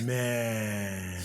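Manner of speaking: deep bass voice. Pretend. Impressed by James Earl Jones bass.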